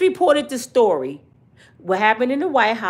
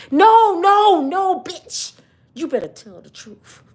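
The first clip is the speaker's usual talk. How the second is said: mock angry demand; 6 semitones higher, 3 dB louder, slower than usual. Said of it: "She wanted Little Hands".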